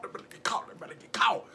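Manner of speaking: soft, breathless, conspiratorial whisper. Real, not acted. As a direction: hush it so they can't hear. Whispering as they get loud.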